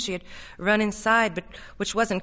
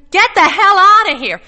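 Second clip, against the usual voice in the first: high, very loud order; desperate full-volume yell; frightened shout; 11 semitones higher, 16 dB louder than usual. A narrator's reading. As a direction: scream at him to go away. Connie orders Arnold away.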